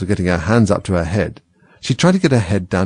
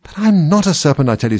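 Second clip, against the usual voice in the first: insist, higher; protesting Alice; 6 semitones higher than usual. A narrator's reading. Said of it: "said Alice".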